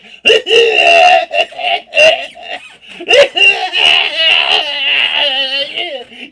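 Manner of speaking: loud, high embarrassed laughing; real cackling laugh; loud laughter. Real, not acted. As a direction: laugh it off loudly. Laughing at her own story.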